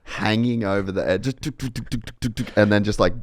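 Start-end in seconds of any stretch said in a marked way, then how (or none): 1.4-2.6 quick run of higher-pitched "tuk" noises; rapid imitated clicking. Describing photographer hanging over the edge, rapid mimicked clicking.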